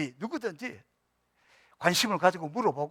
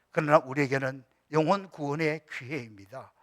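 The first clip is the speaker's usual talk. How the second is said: solemn prayer voice growing louder; closing prayer; 6 dB louder than usual. Acted. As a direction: lead the congregation in prayer, building. (점점 힘이 실리는 엄숙한 기도)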